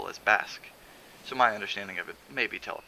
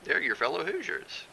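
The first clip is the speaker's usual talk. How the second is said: Hazel's voice; 8 semitones higher than usual. A narrator's reading. Hazel speaking.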